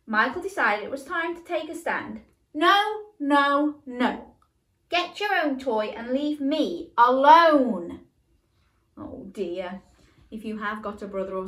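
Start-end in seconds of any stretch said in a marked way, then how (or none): 2.5-8.1 loud, high fed-up no's, slowing; angry refusal. Acted. Michael tells his pestering brother off, loud and fed up. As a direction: draw the line, firmly.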